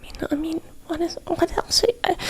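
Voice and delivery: barely audible, high hushed muttering; hesitant whisper. Real, not acted. Reader unsure what waiter could do, hesitant whisper. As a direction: falter under the breath, searching for words.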